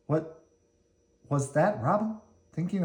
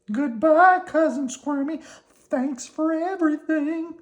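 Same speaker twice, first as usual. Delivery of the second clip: very high worm voice, grateful; Slimy farewell; 4 dB louder than usual. Acted. Slimy says goodbye to Squirmy, in a very high grateful voice. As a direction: wave goodbye warmly.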